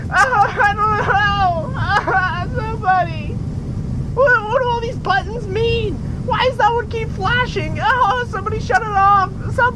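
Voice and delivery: frantic, voice way up in alarm; panicked pilot. Acted.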